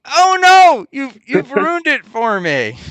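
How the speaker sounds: high, loud wail of dismay; mock dismay. Host acts out someone whose thing got ruined, mock dismay. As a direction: cry out high and drawn out, hammed up. Mocking a reaction in a retold line.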